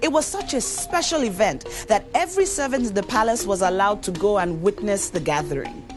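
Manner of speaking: plain reading voice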